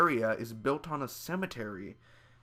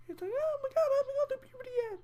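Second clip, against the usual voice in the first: very high squeaky mocking cry; mock kid squeal. Vlogger mocks Griffin's scream, very high squeaky voice. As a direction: parody a prepubescent boy's squeal. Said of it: "Griffin's kinda like".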